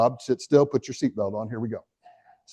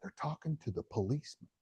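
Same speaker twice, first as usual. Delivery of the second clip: put-on very soft hushed child's line; little boy whispering; 4 semitones higher, 15 dB quieter than usual. Boy tells the realtor who his parents talk to, whispering. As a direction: keep it to a secretive whisper. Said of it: "he's whispering".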